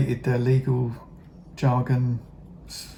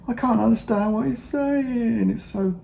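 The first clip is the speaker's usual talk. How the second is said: frustrated whine; 11 semitones higher than usual. Real, not acted. Viewer complaining about a presenter he can't follow, frustrated whine.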